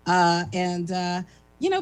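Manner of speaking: normal talking voice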